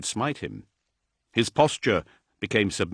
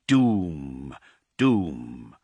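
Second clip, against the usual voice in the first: each doom lower, dying away; fading doom echo; 6 semitones lower than usual. A narrator's reading. Narrator echoing the doom bells, sinking lower. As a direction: let the bell echo sink lower and lower.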